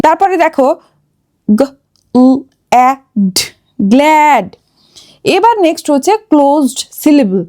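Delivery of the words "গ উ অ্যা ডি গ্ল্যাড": গ, উ, অ্যা, ডি apart, then গ্ল্যাড blended; sounded-out short vowel. Shown as a demonstration. Teacher giving another short-sound example, word sounded out slowly. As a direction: tap out each sound with pauses, then say গ্ল্যাড. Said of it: "short sound করে থাকে".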